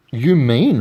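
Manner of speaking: loud, amazed burst; astonished gasp. A narrator's reading. Horton amazed by the tiny voice, astonished gasp. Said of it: "Horton gasped".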